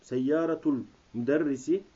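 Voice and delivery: usual speaking voice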